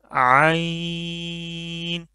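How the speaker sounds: आइन pressed from the middle of the throat, held very slowly; very slow throaty आइन; throaty ain. Shown as a demonstration. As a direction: squeeze आइन from mid-throat. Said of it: "वहाँ से ये निकलेगा आइन".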